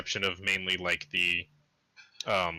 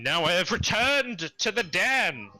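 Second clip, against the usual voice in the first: grand announcement; 9 semitones higher, 3 dB louder, slower than usual. Pretend. Dramatic return announcement.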